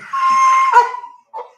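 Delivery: real helpless laugh shout; loud laugh. Real, not acted. Laughing at goldfish joke.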